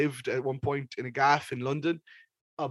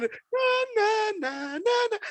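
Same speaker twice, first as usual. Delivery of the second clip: mock shriek; slower than usual. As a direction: shriek the na's way up high over the track. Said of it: "she's just shrieking over it".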